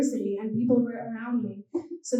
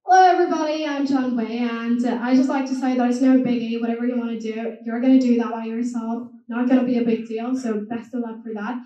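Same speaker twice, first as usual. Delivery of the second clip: easygoing Australian accent, no biggie. Shown as a demonstration. Greeting the audience as a demo, Australian accent. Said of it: "it's kinda like Australian".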